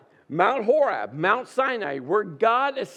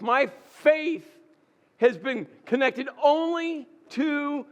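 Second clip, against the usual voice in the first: raised, higher pleading cry to God; Elijah's impassioned protest; 5 semitones higher than usual. Acted.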